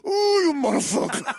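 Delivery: high, laughing curse; mock Sicilian foreman. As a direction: groan it like an exasperated boss. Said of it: "He goes".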